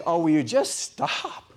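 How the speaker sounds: a fed-up but caring voice; exasperated Lord. Retold or imitated. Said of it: "And the Lord is saying".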